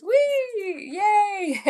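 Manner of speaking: high-pitched, drawn-out squeal of joy; delighted cheer. Real, not acted. Streamer celebrating understanding French, delighted high-pitched cheer.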